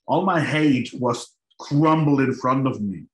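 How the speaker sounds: ordinary delivery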